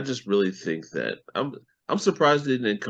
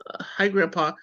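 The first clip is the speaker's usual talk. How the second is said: hesitant, higher mock-awkward greeting; uncomfortable; 10 semitones higher than usual. Kid made to greet a new grandfather, imitated uncomfortable. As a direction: imitate a kid's reluctant hello. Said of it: "Ryan looks so uncomfortable saying that".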